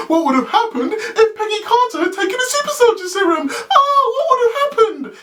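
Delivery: very high, squealed mock wonder; mock excitement. Reader ridiculing the show's example premise, squealed mock excitement. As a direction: squeal it like an overblown cliffhanger.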